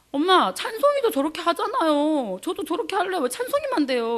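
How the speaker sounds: mock whiny kid asking why; child whining. Son complaining to mother about his brother, lightly voiced whiny child.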